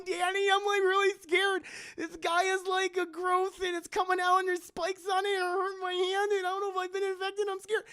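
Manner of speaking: very high, loud mock-frightened wife; hammed-up terror in falsetto; scared wife. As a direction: squeal a panicky plea to her husband. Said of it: "I've never heard my wife scare before".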